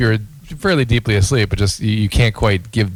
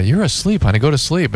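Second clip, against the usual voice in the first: mother soothing; 6 semitones higher than usual. Retold or imitated. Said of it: "she goes".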